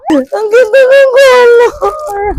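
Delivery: high, drawn-out squeal through laughter; delighted squeal. Real, not acted. Vlogger admiring the new colour, delighted squeal. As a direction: gush it high and slow, laughing.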